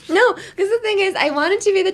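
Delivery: high, loud protest through laughter; laughing protest. Real, not acted. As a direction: protest while cracking up. Laughing while protesting her teasing.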